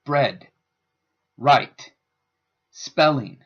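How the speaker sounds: Bread, right, spelling each said slowly and clearly; example words read out. Shown as a demonstration. Teacher reading out sample words clearly. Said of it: "The I P A makes it easier to pronounce words".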